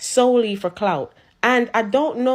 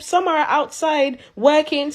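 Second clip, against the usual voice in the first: ordinary talk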